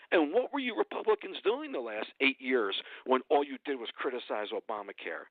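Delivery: usual speaking voice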